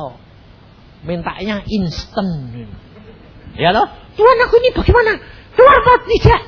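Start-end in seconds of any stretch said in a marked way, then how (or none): 4.1-6.4 high, fast, tearful crying out; desperate prayer. Retold or imitated. Frightened man crying out to God, fast high tearful plea. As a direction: wail the prayer in panic.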